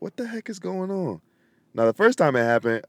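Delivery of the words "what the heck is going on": puzzled. Retold or imitated. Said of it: "and I'm like".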